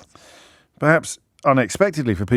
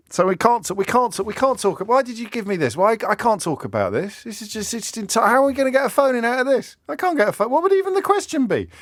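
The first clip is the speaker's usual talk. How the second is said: high, rising exasperated protest; flustered exasperation; 11 semitones higher than usual. Real, not acted. Presenter complaining he can't get a phone-in from this, exasperated. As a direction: protest helplessly, pitch climbing higher and higher.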